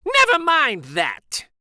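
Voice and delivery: high, loud snapping retort; strict teacher snapping at the kids; snapped. A narrator's reading. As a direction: cut them off sharply. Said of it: "snapped Miss Swamp".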